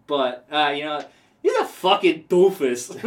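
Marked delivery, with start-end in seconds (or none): 1.4-2.9 put-on a light Puerto Rican accent, a bit higher and louder; mock Puerto Rican accent. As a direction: toss off a playful Puerto Rican accent on the insult. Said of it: "I'm not gonna do the Puerto Rican accent".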